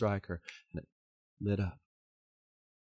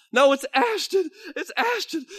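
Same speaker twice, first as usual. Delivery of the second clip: high, loud anguished insistence; Billy pleading it is his wife; desperate plea; 8 dB louder than usual. Acted. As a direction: plead that it's her.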